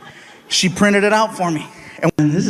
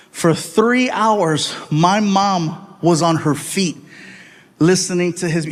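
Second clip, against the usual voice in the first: ordinary talk, nothing marked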